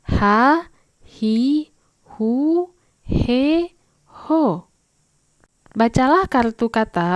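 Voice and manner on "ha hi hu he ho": ha, hi, hu, he, ho each spoken clearly, climbing higher; syllable drill. Shown as a demonstration. Teacher drills the H syllables for pupils. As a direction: read each syllable crisply for the class. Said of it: "kita baca".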